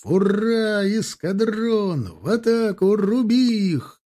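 high, drawn-out charge command; rally the squadron into attack; long, high-pitched war shout; battle cry. A narrator's reading.

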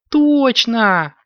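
high, loud burst of agreement; exclaiming kitten. A narrator's reading. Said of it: "воскликнула Карамелька".

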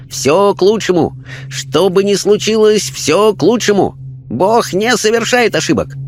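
high, placid voice of a wise spirit; Chinese spirit voice. A narrator's reading. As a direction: speak as a calm, otherworldly Chinese sage. Spirit Chang speaks.

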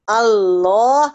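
الله slowly, first lam light and second heavy; light then heavy lam. Shown as a demonstration. Teacher shows the two lams of Allah slowly. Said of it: "اللام الأولانية مرققة".

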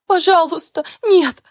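high panicked pleading cry; desperate plea. Acted. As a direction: beg him to stop, terrified. Heroine pleads with husband.

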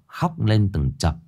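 usual speaking voice

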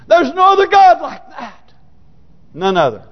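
high, sudden pulpit shout; shouted proclamation. Real, not acted. Preacher exalting God to congregation, fervent shout. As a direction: burst out with fervent conviction.

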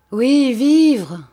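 loud, high cry; impassioned cry. A narrator's reading. Dying countess crying out to Félix, loud and high. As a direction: fling the word out with passion.

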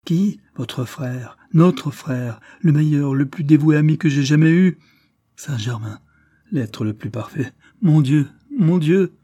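éclat douloureux, plus haut et plus fort; cri de douleur. Acted. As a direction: crier sa peine jusqu'au « mon Dieu » lent et brisé. Lord Louis pleure son frère. (Lord Louis crie sa douleur devant sa mère)

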